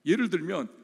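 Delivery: ordinary talk, nothing marked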